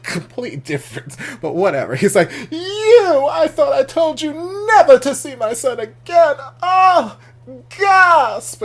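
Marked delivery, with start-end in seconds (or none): 2.5-8.6 high, very loud mock accusation; Daddy's telenovela outrage. Daddy forbids seeing his son, melodramatic. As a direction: wail it with hammed-up soap opera horror. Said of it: "it felt very dramatic telenovela".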